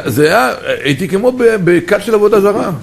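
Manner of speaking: ordinary talk, nothing marked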